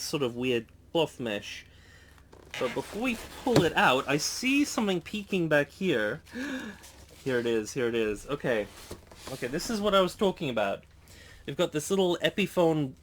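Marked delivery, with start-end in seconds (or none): 6.2-6.7 short high, quiet gasp; excited gasp. Pretend. Unboxer peeking at what is inside, excited gasp. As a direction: gasp softly at the first peek. Mock suspense at peeking into case.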